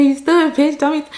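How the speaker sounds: 高而响地骂出来，重读 stupid; 提高嗓门，带着火气重演当时的骂声; mock angry yell. Retold bar fight insult.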